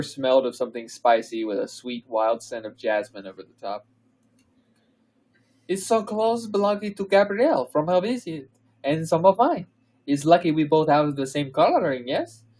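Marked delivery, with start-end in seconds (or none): 5.7-12.4 put-on higher, lilting French accent for Fleur; hammed-up French accent, read aloud. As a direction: Fleur in French, ending on a questioning yes. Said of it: "Are you gonna do the French accent?".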